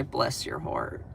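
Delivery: whispered, slow and syrupy "Bless your heart."; southern bless your heart. Pretend. Southern streamer to a hater, whispered slow mock sweetness.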